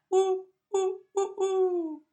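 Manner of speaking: high, loud hooting whoo; imitated owl hoot. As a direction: hoot like an owl outside the window. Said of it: "I'm looking at that".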